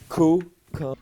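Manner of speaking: loud high yelling; sudden outburst. Acted. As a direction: snap loudly mid-sentence. Producer loses composure on set.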